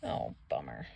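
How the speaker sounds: very low, very soft mock gloom; low disappointed aside. Real, not acted. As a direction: sink into a deep mumble of disappointment. Aside as Ferdinand is carted away.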